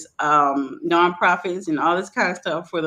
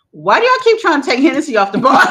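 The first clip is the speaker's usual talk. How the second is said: loud, fast, high-pitched questioning; retold confrontation, demand a real answer; indignant challenge; 8 semitones higher, 8 dB louder, faster than usual. Bartender challenging whoever wants Hennessy off the bar, indignant.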